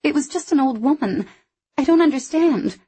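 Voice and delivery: pleading, pitch climbing high; near-tearful desperate insisting. Acted. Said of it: "I said desperately".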